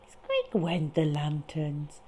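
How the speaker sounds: plain reading voice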